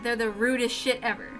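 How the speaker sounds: ordinary delivery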